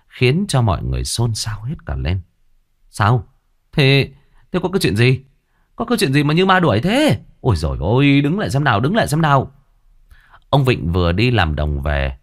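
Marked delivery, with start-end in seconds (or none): 2.9-9.5 put-on raised, urgent old man's call; startled neighbour shouting stop. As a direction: call after the running boy to stop. Old man calls to fleeing boy.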